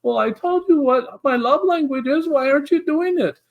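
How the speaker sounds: high, whiny complaining voice. Retold or imitated. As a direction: whine it like a needy partner. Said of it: "the whiny voice that says".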